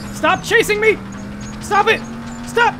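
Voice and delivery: yelling stop while being chased; frantic shouts to back off. Real, not acted. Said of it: "I'm literally being chased".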